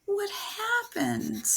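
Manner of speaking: a hint of a worried mother; mom's voice. Shown as a demonstration. Teacher voices a mom asking her son what happened, caring. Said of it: "Mom asked".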